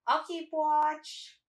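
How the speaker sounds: high, loud, drawn-out offer; eager volunteering. Acted. Adventurer volunteers to the party for first watch, high drawn-out eagerness. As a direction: sing out the offer, stretched and high.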